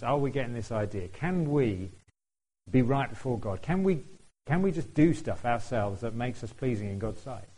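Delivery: usual speaking voice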